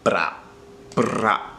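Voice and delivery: Bra with a trilled r after the b; rolled r. Shown as a demonstration. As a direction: bra buzzed, the r vibrating. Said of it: "the noise we're working towards".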